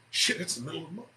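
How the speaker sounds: normal talking voice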